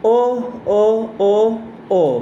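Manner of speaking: O, O, O, O, each with a new inflection, first ones high; varied O exclamations. Shown as a demonstration. Teacher demonstrates Patal Babu's practice, varied O cries. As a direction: play with the O, changing the tune each time. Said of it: "a different inflection each time he uttered it".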